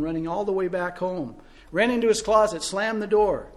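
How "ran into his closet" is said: ordinary delivery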